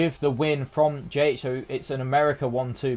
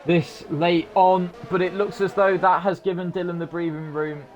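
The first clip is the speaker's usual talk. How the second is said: raised pitch, driving race-finish call; live race commentary; 6 semitones higher than usual. Real, not acted. Commentator calls the Monaco winner to viewers, excited race call.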